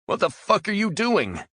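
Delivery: high, loud shriek of alarm; panicked scream. Acted. Prisoner dragged over the silo edge, panicked scream. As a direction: yell in sudden terror.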